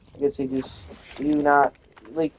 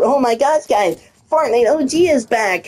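high mock-excited announcement; sarcastic cheer; 9 semitones higher than usual. Real, not acted. Gamer mocking Fortnite OG return, high sarcastic cheer. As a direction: fake celebration, dripping sarcasm.

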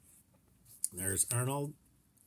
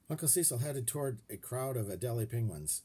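ordinary delivery, nothing marked

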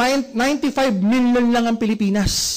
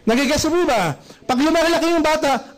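normal talking voice

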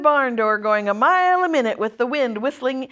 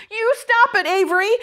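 high-pitched protest to a brother; urgent scolding; 10 semitones higher, 4 dB louder than usual. A narrator's reading. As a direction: cry out to make him stop. Sister orders brother to stop.

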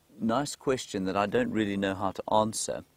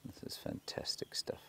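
whisper; 9 dB quieter than usual. Real, not acted. Quiet watching at wildlife sighting.

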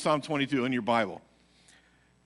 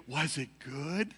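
amused question; 5 dB quieter, slower than usual. Retold or imitated. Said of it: "looks at me and goes".

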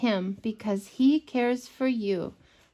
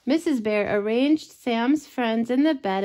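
ordinary delivery, nothing marked

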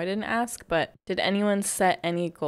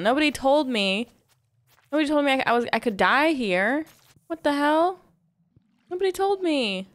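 upset yelling; 7 semitones higher, 5 dB louder than usual. Real, not acted. Panicked outburst at attack.

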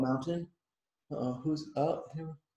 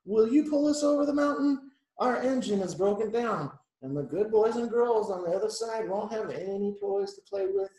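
higher-pitched begging; pleading cry; 6 semitones higher, 4 dB louder than usual. A narrator's reading. Dolls begging the blue engine, pleading. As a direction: implore like stranded little toys. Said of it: "cried the dolls and toys".

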